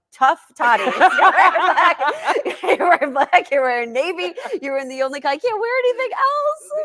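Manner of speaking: high, loud comic griping through laughter; laughing mock exasperation. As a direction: playfully protest the limited wardrobe, voice climbing. Replaying wardrobe complaint, laughing.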